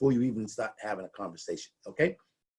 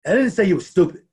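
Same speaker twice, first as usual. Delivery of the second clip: didn't hit hard and louder; emphatic didn't; stress on didn't; 5 semitones higher, 6 dB louder, faster than usual. Shown as a demonstration. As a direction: insist on didn't while meaning the opposite. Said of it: "So I'm gonna do it this way".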